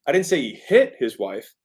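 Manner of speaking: "hit" punched out of the sentence; emphasis falls on "hit"; stress on HIT. Shown as a demonstration. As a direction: land hard on the word "hit". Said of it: "The emphasis is".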